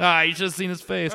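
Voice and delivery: high, loud, put-on tough guy voice; hammed-up gangster breaking the tension; mock gangster laugh-off. Gangster to his shaken friend, a high hammed-up grin. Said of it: "he's like".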